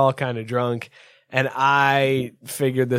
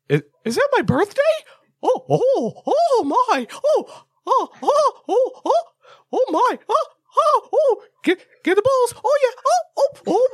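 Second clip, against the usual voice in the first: very high falsetto cartoon mouse, hammed-up gasping; Mickey Mouse. Joker performs lewd cartoon mouse bit for bus, squeaky falsetto. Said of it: "drop a Mickey Mouse voice".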